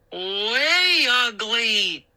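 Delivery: slow drawn-out booming voice. A narrator's reading. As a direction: boom it out slowly. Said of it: "he boomed".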